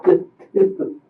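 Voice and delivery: sobbing out the fear of jail; crying. Real, not acted. Telling the investigator she stopped responding, sobbing. Crying while recounting the fall.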